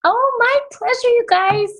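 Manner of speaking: bright, high greeting; excited. Real, not acted. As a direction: gush it out warmly with a big pitch lift. Enthusiastic greeting on arrival.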